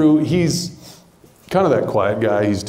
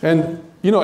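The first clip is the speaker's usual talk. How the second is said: ordinary talk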